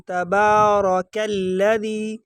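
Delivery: تبارک الذی slowly chanted with a thin k; light kaf. Shown as a demonstration. Teacher modelling the correct letter, slow chant with light kaf in تبارک. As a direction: keep the k in تبارک light, "kal-ladhee". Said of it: "اس کو باریک پڑھیں گے".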